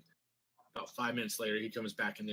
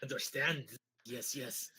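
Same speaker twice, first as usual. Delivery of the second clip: put-on German accent; 4 semitones higher than usual. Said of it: "little Hitler stash".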